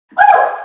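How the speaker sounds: high loud cheering woo; excited whoop. Real, not acted. Coach whoops during workout.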